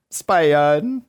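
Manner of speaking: normal talking voice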